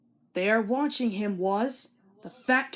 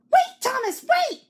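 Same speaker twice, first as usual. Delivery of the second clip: very high, loud squeaky cry; silly trucks shrieking at Thomas to stop; trucks calling out; 8 dB louder than usual. A narrator's reading. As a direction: squeal after him to wait.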